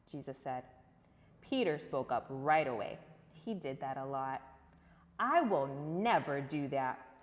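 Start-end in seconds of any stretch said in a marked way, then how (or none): 5.2-7.2 slow, firm promise; drawn out and insistent, every word weighted; Peter's bold vow. Acted. Peter boasting to Jesus, slow and firm. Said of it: "Peter said".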